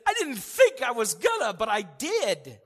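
high, slow, loud cry of relief; relieved surprise. Retold or imitated. Newcomer arriving in heaven, high relieved cry. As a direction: gasp out that you barely made it. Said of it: "And then he said".

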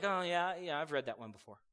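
lightly mocked bored brush-off, trailing away; dismissive attitude. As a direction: shrug it off like you've heard it all. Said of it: "having an attitude toward the word like".

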